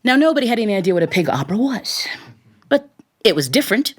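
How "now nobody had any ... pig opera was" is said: ordinary delivery, nothing marked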